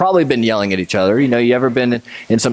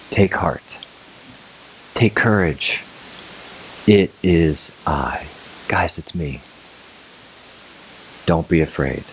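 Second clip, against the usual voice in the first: calm them gently; calm reassurance; 5 semitones lower, 5 dB quieter than usual. Retold or imitated. Jesus comforting his frightened disciples, soft and calm. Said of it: "as calm as can be".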